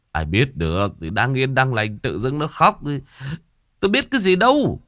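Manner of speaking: indignant protest building to a high outburst; wronged husband, voice climbing sharply at the end; aggrieved. A narrator's reading. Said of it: "Ông ngoại ấm ức".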